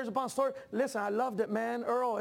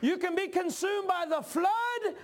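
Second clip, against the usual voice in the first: full-force preaching; 7 semitones higher than usual. Acted. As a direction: preach it, climbing in pitch.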